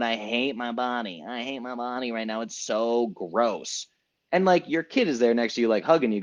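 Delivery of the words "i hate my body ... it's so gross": mimicking mother. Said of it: "this thing six months later".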